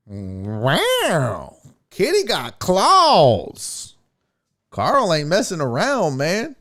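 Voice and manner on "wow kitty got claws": 1 mock impressed. Real, not acted. Reacting to Carl Lewis rant.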